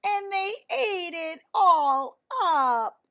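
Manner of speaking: high, loud, slow wailing squeak; Baby Bear finds her soup gone and wails; squeaky crying Baby Bear. A narrator's reading. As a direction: squeak it out like a cub about to cry. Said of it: "in her high squeaky voice".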